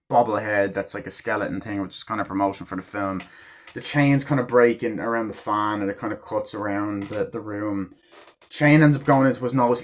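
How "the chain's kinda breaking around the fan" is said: usual speaking voice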